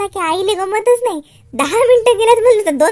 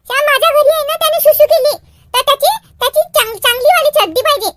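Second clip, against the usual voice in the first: put-on high, eager child voice; little girl Jagruti; 8 semitones higher, 4 dB louder, faster than usual. Girl asks Shyam's mother for clean pants, little-girl voice. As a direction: report it like an excited little girl.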